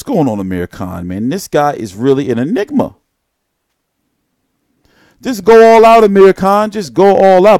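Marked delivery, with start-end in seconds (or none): 5.2-7.6 loud, high urging shout. Real, not acted. Fan yelling at Amir Khan to fight all out, loud urging shout. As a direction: yell it like a fan pleading.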